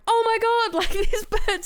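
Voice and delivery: high, loud disbelief with a laugh; retold amazement. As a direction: react in stunned delight at the coincidence. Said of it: "And I was like".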